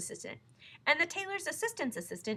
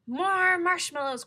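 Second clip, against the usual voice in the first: loud, slow, higher demanding cry; cried out; 9 semitones higher, 13 dB louder, much slower than usual. A narrator's reading. Lion crying out to the waiter for more. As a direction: call out for more. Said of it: "cried the lion".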